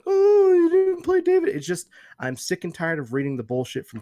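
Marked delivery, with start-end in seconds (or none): 0.0-1.5 loud, very high mock whine; hammed-up imitation of whining fans; whiny. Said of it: "so many whiny bitches out there like".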